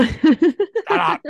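high, laughing and loud; laughing shut up. Real, not acted. Laughing at his cohost's jab, loud and high. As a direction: laugh the words out at your friend.

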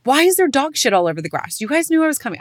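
annoyed outburst. Retold or imitated. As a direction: vent at the family in mock outrage. Angry complaint about dog mess.

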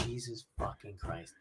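very soft, low and slow; muttered dismay. Retold or imitated. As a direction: mutter it under your breath, slowly. Dismayed inner reaction to kids sitting.

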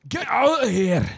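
high, loud hammed-up Scottish shout; mock Scottish shopkeeper. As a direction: bark him out of the shop in a put-on Scottish accent.

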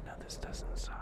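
very soft, intimate whisper; hushed ASMR performance; best ASMR artist. Shown as a demonstration. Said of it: "be my best A S M R artist".

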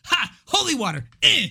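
quick, high comic jab; mock gotcha. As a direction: imitate a gleeful trickster, lightly.

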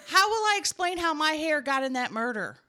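high, loud, hammed-up alarm; mock panic. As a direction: plead in exaggerated alarm. Hammed-up panic about wrongful conviction.

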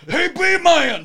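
high, loud hail; Southern call from a distance; calling from afar. Retold or imitated. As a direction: shout it from across the street. Heard in the distance.

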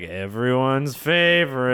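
slow, drawn-out mocking imitation; begrudging. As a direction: drag it out, imitating the host's reluctance. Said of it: "you've been like".

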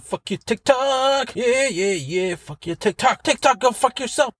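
high, stretched-out mock tirade; hammed-up ranting. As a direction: squeal abuse in a pretend meltdown. Hammed-up rant at TikTok.

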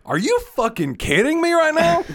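high, loud incredulous outburst; shocked disbelief. Retold or imitated. Brother to sister asking for autograph, high incredulous shout. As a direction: blurt it out in total disbelief.

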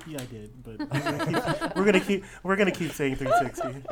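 laughing through the words. Real, not acted. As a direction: say it while cracking up. Laughing through own mistake.

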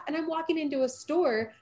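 ordinary delivery

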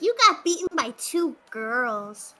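taunting. Acted. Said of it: "be like".

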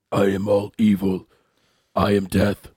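a hint of a dark overlord; menacing villain voice. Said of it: "it's really, like, menacing".

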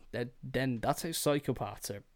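normal talking voice

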